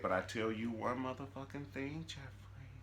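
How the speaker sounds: soft, eerie put-on villain voice; crazy voice. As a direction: half-whisper it like an unhinged soap villain. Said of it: "In her crazy voice".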